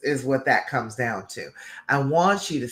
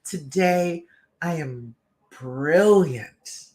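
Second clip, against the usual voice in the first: slow, deliberate, emphatic affirmation; heartfelt conviction; much slower than usual. Shown as a demonstration. Coach showing followers how to affirm themselves, with heartfelt conviction. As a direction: say it slowly, like you truly mean it. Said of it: "say it like you mean it".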